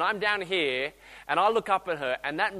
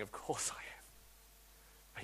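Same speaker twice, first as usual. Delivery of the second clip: soft whisper; 9 semitones lower, 21 dB quieter, much slower than usual. Retold or imitated. God answering softly.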